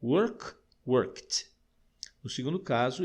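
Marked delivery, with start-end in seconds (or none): 0.9-1.5 worked ending in a crisp T sound. Shown as a demonstration. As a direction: say worked as one syllable, "workt". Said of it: "ele soa como um T".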